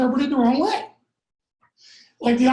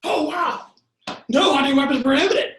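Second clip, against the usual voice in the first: mock thrilled, rising high and loud at the end; hammed-up eagerness to go hunting; joking excitement; 5 semitones higher, 3 dB louder than usual. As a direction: uncle joking to his nephew, mock excited. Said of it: "he used to joke".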